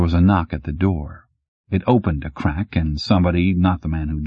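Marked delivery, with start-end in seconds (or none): none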